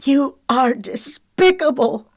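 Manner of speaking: high, loud, slow furious accusation; screamed. A narrator's reading. Lexi enraged at Wiley, screaming. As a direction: scream it out word by word. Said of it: "she screamed".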